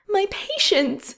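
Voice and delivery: high, slow, stricken cry of horror; a nurse crying out for her patients; anguished cry. Acted. Nurse watching her patients killed, anguished high cry.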